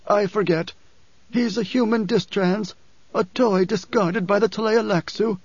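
high, halting, sick man's wheeze; wheezing. A narrator's reading. Ailing Othame explains the dwarf to Paul, wheezing. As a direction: struggle through it, pausing to search for words. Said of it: "Othame wheezed".

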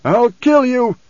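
high, loud deranged cry; raving threat. A narrator's reading. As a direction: rave it like someone possessed. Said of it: "Still, Alia raved".

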